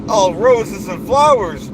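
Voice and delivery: blustering self-important boss voice; pompous ranting persona. Acted. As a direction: puff up like a bragging strongman. Holds the Duke persona rant.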